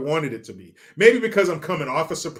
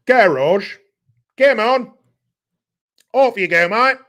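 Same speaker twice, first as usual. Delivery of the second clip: loud, high, hammed-up Aussie-British accent; exaggerated British-Australian caricature; mock British-Australian accent; 7 semitones higher, 9 dB louder than usual. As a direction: shout it in a fake Australian-British twang. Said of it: "Or is that Australian?".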